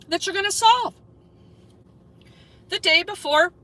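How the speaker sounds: high-pitched, insistent challenge; challenging. Real, not acted. Streamer daring cops to solve a crime, challenging. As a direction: demand it, pitch way up. Mock challenge aimed at the police.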